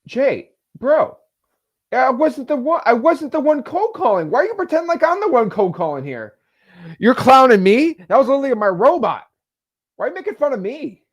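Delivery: loud, high, exasperated complaint; indignant protest. Real, not acted. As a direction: protest being teased, fast. Defends himself against chat teasing.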